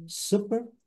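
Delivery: supermarket said rising, stress on super and market; two strong syllables. Shown as a demonstration. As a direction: model supermarket with two beats. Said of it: "duas sílabas fortes".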